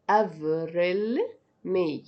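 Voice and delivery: normal talking voice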